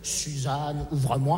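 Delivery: plus grave, beaucoup plus doux; répéter la formule magique à voix basse; répétition marmonnée. Acted. (marmonné tout bas)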